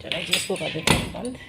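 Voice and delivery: ordinary talk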